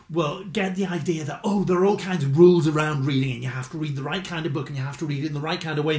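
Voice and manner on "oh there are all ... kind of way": usual speaking voice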